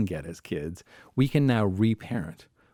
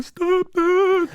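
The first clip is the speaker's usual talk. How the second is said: sudden loud burst; 8 dB louder than usual. Retold or imitated. Wanting daughter to stop.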